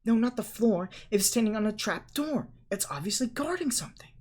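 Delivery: high, indignant and prim; cross girl storming off to bed; huffy scolding. A narrator's reading. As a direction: scold them, then flounce off. Hermione scolding then leaving.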